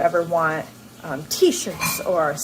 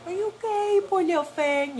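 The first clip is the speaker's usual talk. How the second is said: put-on much higher, sing-song baby talk; cooing to a dog; 11 semitones higher than usual. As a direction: coo at the scared puppy like a pet. Husband cooing to the hurt dog.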